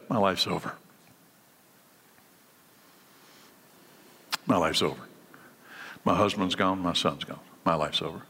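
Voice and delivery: low, soft, grieving lament; a touch of widow's grief. Retold or imitated. Preacher voicing the widow mourning her son, low and sad. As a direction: voice her quiet despair. Widow voiced in sermon story.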